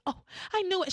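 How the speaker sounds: a touch of manic joy; mock excited gasp of relief; mock excitement. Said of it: "She gets excited".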